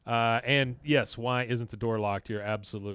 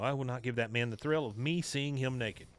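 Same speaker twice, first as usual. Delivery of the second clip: ordinary talk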